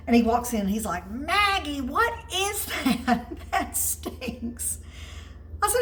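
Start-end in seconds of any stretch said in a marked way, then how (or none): 1.1-4.8 put-on higher, laughing kid voice turning up his nose; child grandson voice. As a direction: play the little boy who hates the smell.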